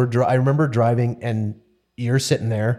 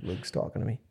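ordinary talk

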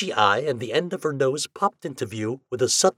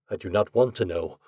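ordinary delivery, nothing marked

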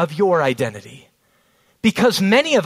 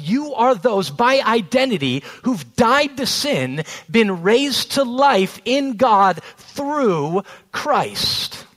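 higher, louder fervent sermon delivery; full-force preaching; 4 semitones higher, 3 dB louder than usual. Acted. Preacher driving home identity to the congregation, fervent and loud. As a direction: preach it out with conviction.

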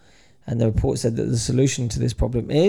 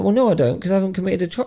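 higher-pitched mock objection; retold indignant refusal; 7 semitones higher, faster than usual. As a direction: imitate a man refusing an officer.

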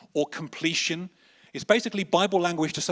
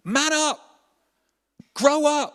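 much higher, loud scolding father voice; harsh dad; 10 semitones higher, 3 dB louder than usual. Acted. Dad scolding his panicking son, loud and high. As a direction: bark at the crying boy like a tough dad. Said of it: "his dad comes to him and say".

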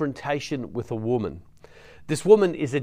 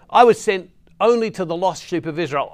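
firm declaration; 6 semitones higher, 6 dB louder than usual. Acted. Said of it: "and he says".

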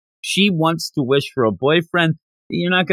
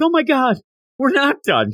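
excited; 10 semitones higher than usual. Retold or imitated. Bulma's relieved outburst.

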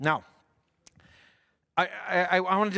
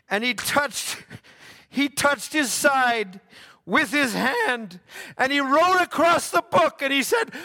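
higher, slow, swelling preacher's telling; building sermon narration; 9 semitones higher, 4 dB louder than usual. A narrator's reading. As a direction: build the moment toward the climax.

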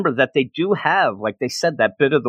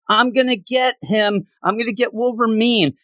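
high-pitched eager declaration; excited child's battle cry; 7 semitones higher than usual. Retold or imitated. Retelling a girl's fight with Wolverine, excited. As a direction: call it out like a kid play-fighting.

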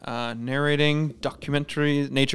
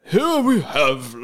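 slow, drawn-out nature narrator; raised, stretched-out narrator impression; David Attenborough; 4 semitones higher, 3 dB louder, much slower than usual. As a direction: intone it like a wildlife documentary voice-over. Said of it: "He does the narration for all Planet Earth things".

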